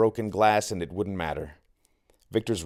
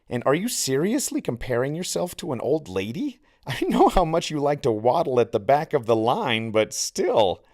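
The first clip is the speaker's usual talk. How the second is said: mocking sneer; 6 semitones higher, 4 dB louder than usual. A narrator's reading. Victor mocking Santi.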